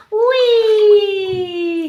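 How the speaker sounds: high, loud joyful whee; gleeful cry. Acted. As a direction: shout with delight sliding down. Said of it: "he cried".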